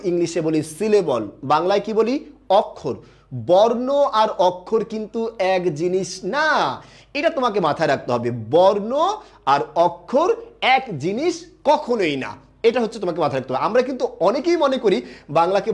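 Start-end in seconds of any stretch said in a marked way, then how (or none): 3.5-6.8 slow and deliberate, না long drawn out; slow emphasis. Shown as a demonstration. Teacher warning students about confusing terms, slow emphasis ending on long না. Teacher stressing a key distinction. 8.3-12.2 slow, drawn-out words building to কখনোই; slow emphasis. Shown as a demonstration. Teacher insisting on the distinction to students, slow emphasis on কখনোই.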